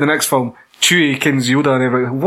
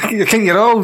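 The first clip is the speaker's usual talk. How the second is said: ordinary delivery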